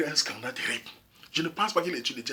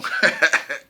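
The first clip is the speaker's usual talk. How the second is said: short laugh; 5 dB louder than usual. Real, not acted. Laugh mid-sentence.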